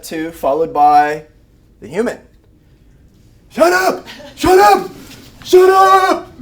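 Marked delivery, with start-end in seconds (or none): 3.5-6.3 high, loud yelling at a barking dog; frantic scolding. Acted. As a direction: act out a frantic owner, rapid-fire and high-pitched. Owner yelling at barking dog, acted.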